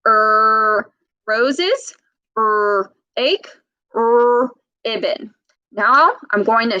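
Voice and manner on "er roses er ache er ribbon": Er held before each word roses, ache, ribbon; er beats before each word; er drill. Shown as a demonstration. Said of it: "keep your tongue a little bit backwards".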